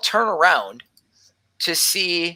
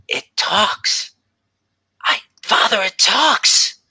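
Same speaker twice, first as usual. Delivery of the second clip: put-on higher and louder, wide-eyed child; amazed little girl; 7 semitones higher, 4 dB louder, slower than usual. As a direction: burst out in wonder to her father. Gelby amazed at the bird.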